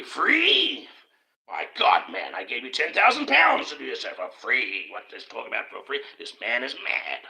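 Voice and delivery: outraged money man who paid for this; mock magnate blustering at Tesla; scoffing tycoon.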